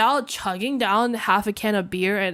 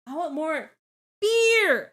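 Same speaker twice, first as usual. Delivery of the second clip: tipsy shout; 7 semitones higher, 4 dB louder than usual. Real, not acted. Tipsy streamer calling out to chat for more beer, loud and high. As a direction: belt out the word beer like a tipsy toast.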